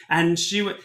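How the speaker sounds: ordinary delivery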